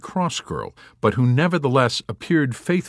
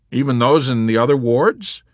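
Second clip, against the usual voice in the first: high, loud, incredulous question; alarmed question; 6 semitones higher, 7 dB louder than usual. Acted. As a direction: ask loudly, rising in disbelief. Yossarian questions chaplain, who echoes softly.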